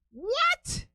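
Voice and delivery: can't believe what you just heard; incredulous what. Real, not acted. A man mocking his younger self for skipping [name], shocked.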